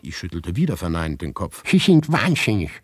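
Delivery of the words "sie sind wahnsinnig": much higher, louder woman's voice; high-pitched neighbor. A narrator's reading. Neighbor Maria asks the narrator in the corridor, high-pitched. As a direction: play the fussy neighbor high and loud.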